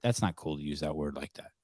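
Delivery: quiet, even, no heat; a calm word to the rude buyer. Shown as a demonstration. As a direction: calmly set him straight. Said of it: "in a really calm way".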